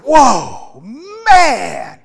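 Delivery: loud, high Whoa, man!; a stunned, delighted shout at first sight; Adam gasping in awe; amazed Adam. Acted. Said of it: "And Adam says".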